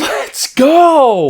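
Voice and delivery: real excited cheer; celebration cheer. Real, not acted. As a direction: hype it up. Cheering with the host.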